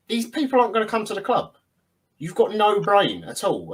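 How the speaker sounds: high-pitched, incredulous comeback; exasperated retort. Retold or imitated. Fan answering people shouting manager names at him, exasperated.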